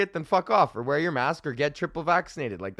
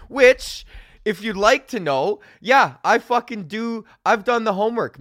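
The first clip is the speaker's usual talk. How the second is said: proud and loud; 6 semitones higher, 7 dB louder than usual. Real, not acted. Proudly boasting about the word.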